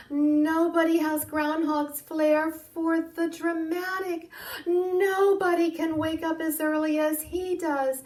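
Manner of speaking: mayor hyping up the absent groundhog; mayor's praise. Acted. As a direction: praise him grandly as the mayor. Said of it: "the mayor said".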